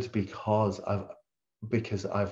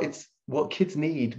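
ordinary delivery

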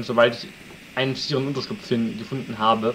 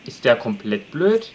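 ordinary delivery

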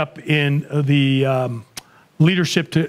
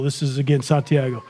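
ordinary delivery

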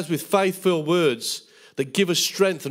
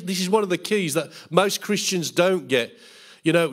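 usual speaking voice

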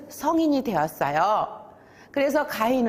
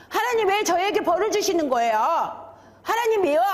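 high indignant appeal; wailing protest; 8 semitones higher than usual. Acted. Cain objecting to God about his punishment, high acted protest. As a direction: plead why, pitch thrown right up.

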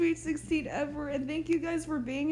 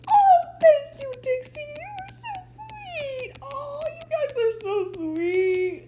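high, loud, choked-up crying thanks; breaking into tears while thanking friends; teary thanks; 10 semitones higher, 5 dB louder, slower than usual. Real, not acted. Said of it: "I'm about to cry".

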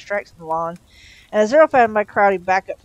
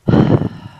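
loud breathy sigh of exasperation; exasperated groan; 10 dB louder than usual. A narrator's reading. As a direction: heave a loud, voiceless groan. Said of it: "Crowley groaned".

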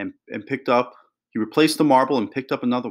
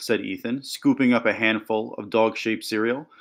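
ordinary delivery, nothing marked

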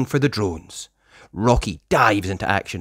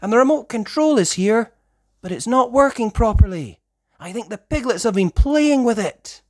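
higher, explaining; girl pup voice; 10 semitones higher than usual. Acted. Sky reports the broken remote, higher pup voice. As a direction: report what you see.

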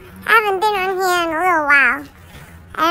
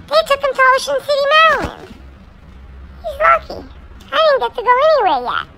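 higher and fast, envious of Snooki's trip; envious whine; 11 semitones higher, 4 dB louder than usual. Acted. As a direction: rattle it off, high and jealous. Jealous of Snooki's vacation.

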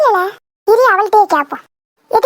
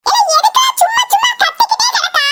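high-pitched scolding yell; shrieky, irritated telling-off; angry scolding; 11 semitones higher than usual. Acted. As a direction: scold in a much higher voice. Pupil scolds another in acted quarrel.

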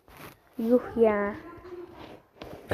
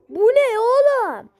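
shocked cry; 8 semitones higher, 4 dB louder than usual. Real, not acted. Shocked at what appears.

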